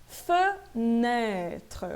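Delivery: "Fenêtre" drawn out as feu-nê-tre; careful syllable demo. Shown as a demonstration. Teacher showing the unaccented first E, syllable by syllable. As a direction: split "Fenêtre" slowly, open ê, faint final E. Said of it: "Esse primeiro E não está acentuado".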